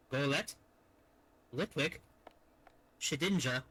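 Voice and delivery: ordinary delivery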